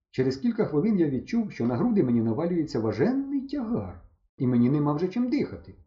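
ordinary delivery, nothing marked

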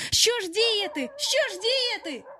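high, anxious repeated plea; panicked question. A narrator's reading. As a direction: ask helplessly in a high, frightened voice. Boys panicking before the wolves. (панічне запитання високим голосом)

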